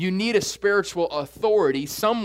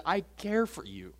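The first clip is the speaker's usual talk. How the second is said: a touch of tender, much softer; 7 dB quieter than usual. Acted. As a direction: say it gently like a caring father. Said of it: "He has a tender heart and says".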